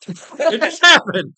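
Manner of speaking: high, loud, slow laughing. Real, not acted. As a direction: laugh it out helplessly. Laughing at own accent slip.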